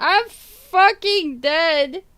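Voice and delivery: slow, high, loud cry of amusement; hysterical amusement. Real, not acted. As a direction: stretch it out loud and high, cracking up. Cracking up at a chat comment.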